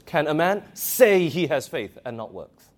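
"say" stretched and punched, the rest of the question falling away; stress on SAY. Shown as a demonstration. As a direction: hit "say" hard. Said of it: "listen to my emphasis".

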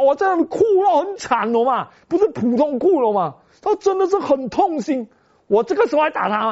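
preaching at full force. Acted. Pastor telling the church of his son's tears, impassioned. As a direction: let the testimony swell with feeling.

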